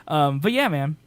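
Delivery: usual speaking voice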